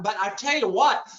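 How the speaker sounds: normal talking voice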